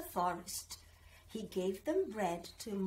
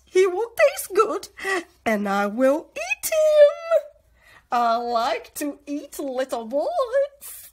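much higher, loud cackly witch voice; 9 semitones higher, 16 dB louder than usual. A narrator's reading. Old witch inviting the children in, high and loud. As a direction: lure them in like a sweet-talking witch. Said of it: "the witch told Gretel".

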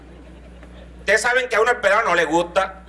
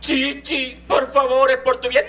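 higher, sweet pleading; doting mother; 6 semitones higher, much slower than usual. Retold or imitated. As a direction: coax the child gently like a pampering mom. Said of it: "La mamá empezó".

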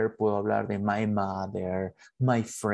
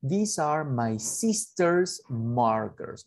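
very slow, careful English; teacher modelling English slowly; slow model sentence; 3 semitones higher, much slower than usual. Shown as a demonstration. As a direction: dictate it slowly. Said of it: "Yo puedo decir".

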